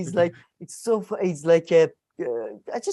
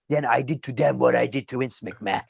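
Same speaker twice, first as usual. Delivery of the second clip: a lighter hint of the Ventura accent; deep Minnesotan accent; 6 semitones lower than usual. Keeps voicing Ventura on Vince McMahon, low Minnesota accent. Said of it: "that deep Minnesotan accent".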